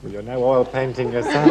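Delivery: usual speaking voice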